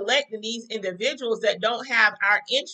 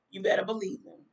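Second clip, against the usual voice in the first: ordinary talk, nothing marked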